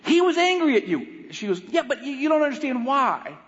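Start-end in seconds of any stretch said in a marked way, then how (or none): 0.1-1.3 high, heated comeback; exasperated retort. Retold or imitated. Husband argues back, retold. 1.7-3.4 higher-pitched argumentative reply; insistent rebuttal. Retold or imitated. Wife argues back, retold.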